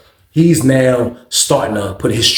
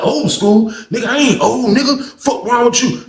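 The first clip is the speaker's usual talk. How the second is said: pitched-up imitation of an offended man; mock indignant retort; 9 semitones higher than usual. Man answering the old school jab, mock indignant. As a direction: throw it back high and quick. Acted angry retort in quarrel.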